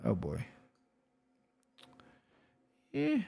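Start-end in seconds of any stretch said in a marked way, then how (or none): none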